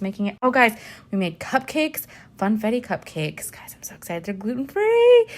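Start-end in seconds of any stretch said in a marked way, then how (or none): 3.5-4.2 soft breathy excited whisper. Real, not acted. Telling viewers about cupcakes in a whisper. 4.2-5.3 excited. Real, not acted. Excited about cupcakes.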